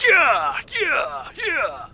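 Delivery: high loud macho yelling; Larry the Lobster voice. Retold or imitated. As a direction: shout it like a pumped-up lobster. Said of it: "That's the voice I hear every time".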